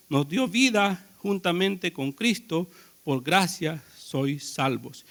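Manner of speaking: quiet, measured Bible reading, slowing at the end; hushed reverent reading; scripture reading. Acted. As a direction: read the verse softly and slowly to the congregation. Reads Efesios 2 aloud.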